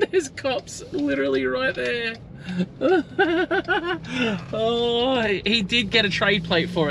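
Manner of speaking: high, laughing disbelief. Real, not acted. Vlogger laughing at the cops waiting at the lights, high giggling. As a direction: talk through giggles, way up high.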